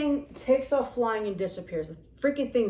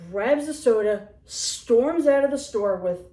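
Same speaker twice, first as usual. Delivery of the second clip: ordinary talk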